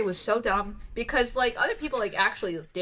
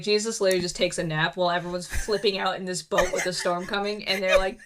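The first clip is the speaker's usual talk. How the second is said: ordinary delivery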